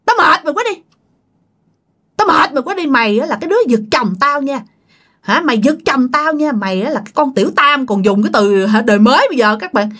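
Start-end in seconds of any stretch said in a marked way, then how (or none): 0.1-7.9 high-pitched, imitated jealous ghost wife; acted ghostly accusation, high voice; possessed spirit scolding. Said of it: "cái giọng anh hai thấy ghê lắm".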